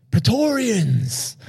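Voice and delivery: high, slow rallying call; imitating Maximus. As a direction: call out the name slowly like a general. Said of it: "and he says".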